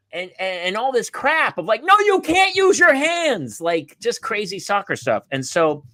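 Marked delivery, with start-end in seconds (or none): 1.8-3.6 very high strained call; mock sideline yelling. Parent correcting kid in soccer, high mock yell. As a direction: imitate an exasperated soccer parent hollering. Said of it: "you're yelling at the kid".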